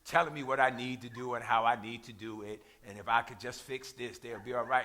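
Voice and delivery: low, quiet, tired grumble; mock weary. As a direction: play a worn-out listener sighing at what's coming. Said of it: "God is tired of hearing you talk".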